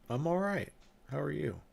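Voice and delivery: usual speaking voice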